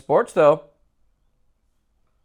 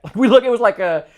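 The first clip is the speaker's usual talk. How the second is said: ordinary talk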